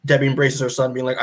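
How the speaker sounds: ordinary talk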